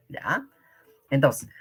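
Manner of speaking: normal talking voice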